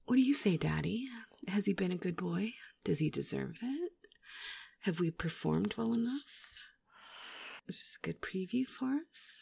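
breathy intimate whisper. Real, not acted. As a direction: whisper close to the ear. Teasing whisper.

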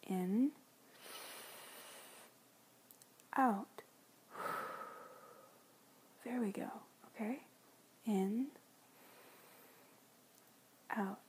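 coaching the breath in and out; steady breathing cues; guided breathing. Acted. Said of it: "Just calm down. Breathe".

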